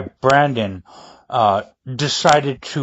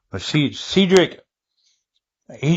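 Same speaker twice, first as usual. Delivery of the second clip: ordinary talk, nothing marked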